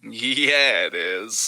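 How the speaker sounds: drawn-out, falsely enthusiastic yeah; sarcastic enthusiasm. Real, not acted. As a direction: feign enthusiasm with heavy sarcasm. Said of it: "said it with that much enthusiasm".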